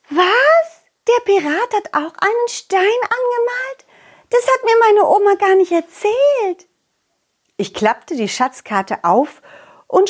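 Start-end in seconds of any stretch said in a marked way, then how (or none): 0.1-6.7 put-on much higher surprised little girl voice; amazed butterfly girl. Butterfly girl amazed that the pirate painted a stone too, much higher. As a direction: gasp at the news like a little girl.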